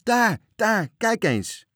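high, loud lookout's cry; calling from the mast. A narrator's reading. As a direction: shout down to the crew, urgently pointing out a sight. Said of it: "riep vanuit de mast opnieuw".

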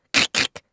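imitating a key turning, whispered; whispered lock-click noise. Child miming locking her mouth, whispered clicks.

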